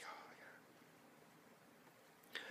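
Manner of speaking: barely audible whisper; whispered oh my god. Retold or imitated. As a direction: breathe it out under your breath. Awkward scene, hushed reaction.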